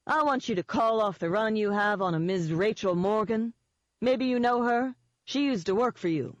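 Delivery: a hint of needling relish; smug Eden. Eden telling Denon to call off the run, lightly smug.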